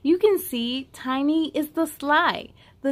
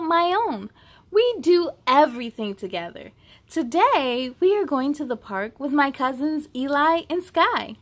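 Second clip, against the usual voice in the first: plain reading voice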